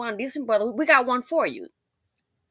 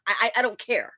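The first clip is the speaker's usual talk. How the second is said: ordinary talk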